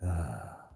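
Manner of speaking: long, falling "ah" sigh; frustrated sigh. Real, not acted. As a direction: let out a sigh that slides down. Frustrated with the phone screen.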